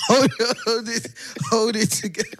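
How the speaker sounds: begging him not to laugh while laughing yourself; pleading through laughter to keep a straight face; laughing plea. Retold or imitated.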